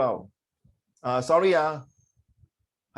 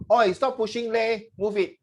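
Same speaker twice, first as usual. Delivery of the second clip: high Singlish with leh tacked on; 6 semitones higher than usual. Shown as a demonstration. Teacher shows heavier Singlish to the class, higher. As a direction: push the leh like a local. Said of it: "even more a Singlish".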